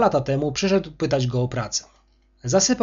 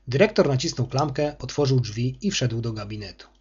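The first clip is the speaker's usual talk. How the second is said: ordinary delivery, nothing marked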